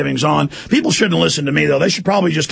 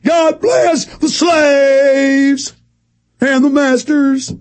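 high, slow, shouted mock patriot anthem; mocking obedient citizen; 9 semitones higher, 3 dB louder, much slower than usual. Host mocking listeners as proud slaves, slow ranting shout. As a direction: belt it out like a brainwashed flag-waver mocking them. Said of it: "and you'll go".

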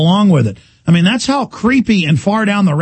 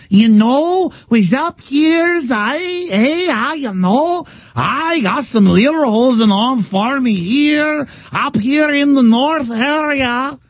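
put-on much higher, slow Minnesota accent; mock Minnesota accent; 9 semitones higher, much slower than usual. Host mocking Palin in a high Minnesota accent. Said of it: "that's not what the Minnesota accent sounds like".

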